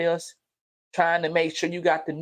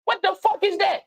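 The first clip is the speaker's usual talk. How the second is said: incredulous outburst; 11 semitones higher than usual. Real, not acted. Father scorning a womanizer upbringing, incredulous high outburst. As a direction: throw the question up in disgust.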